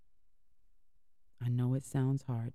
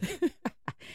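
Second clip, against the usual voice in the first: sudden high giggle at the slip; host laughing at flubbing a show title; high embarrassed laugh. Real, not acted. Laughs at own slip on show name.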